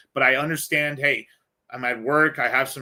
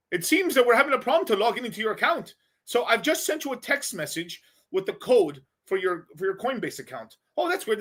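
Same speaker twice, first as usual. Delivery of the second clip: higher-pitched mock Indian call-centre accent; 5 semitones higher than usual. Scammer asking a Coinbase customer for their password, mock Indian accent. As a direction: do an Indian support agent politely working a scam. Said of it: "I'm trying to do my best Indian voice".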